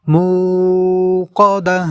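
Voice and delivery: মুকাদা with মু stretched very slowly; drawn-out mu. Shown as a demonstration. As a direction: hold the মু long, then finish. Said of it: "এই মু এই জায়গায় আমরা টেনে পড়ব". (মু টেনে ধীরে মুকাদা)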